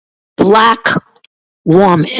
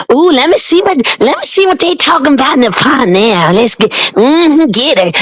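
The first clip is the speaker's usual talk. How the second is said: mock gossip; 7 semitones higher than usual. Mocking gossiping women online.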